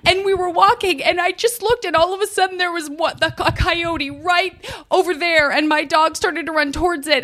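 high whiny retelling in her voice; quoting the neighbor in a high mock voice; mimicking the woman. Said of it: "She was like".

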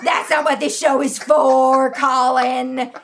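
mock scolding. Scolding the fake caller.

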